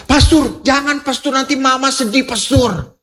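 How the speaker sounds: high-pitched mock pleading; acted begging. Woman begging the pastor not to upset Mama, acted begging.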